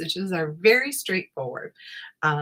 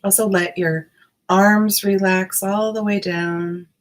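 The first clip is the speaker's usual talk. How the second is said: guided meditation. Acted. Guided meditation body scan.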